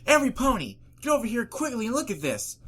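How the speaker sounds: high, loud panicked call; full of panic. A narrator's reading. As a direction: call everyone over in alarm. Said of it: "was full of panic".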